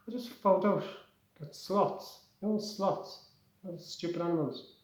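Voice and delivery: breathless high dolphin chatter. Acted. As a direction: Flipper breaks news to Fungi, squeaky dolphin voice. Dolphin Flipper talks.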